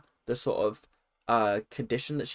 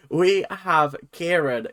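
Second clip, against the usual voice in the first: normal talking voice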